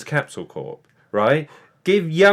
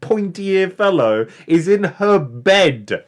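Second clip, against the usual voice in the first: loud, high, slow outrage; retold indignant yell, drawn out; screaming at the TV; 3 semitones higher, 5 dB louder, much slower than usual. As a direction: bellow each phrase slowly in disbelief. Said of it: "I was screaming at the T V".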